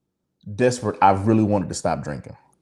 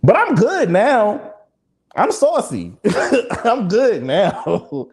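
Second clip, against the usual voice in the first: laughing it off; 9 semitones higher, 3 dB louder, much slower than usual. Real, not acted. Laughing off hurt, slowed.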